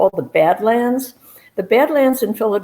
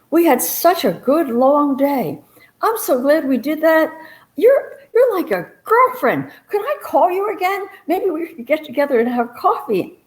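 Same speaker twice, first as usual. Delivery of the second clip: much higher, sweetly gushing friend voice; gushing Doreen; 8 semitones higher than usual. Retold or imitated. Doreen thanks Miss Kitty, sweetly gushing, much higher. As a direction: pour on the delight like a new best friend.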